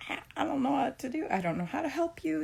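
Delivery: high, helpless exasperation; retold frustration. Mother retelling her helplessness with her child's schoolwork, frustrated. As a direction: voice climbing at wits' end, but not lashing out. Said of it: "clearly frustrated".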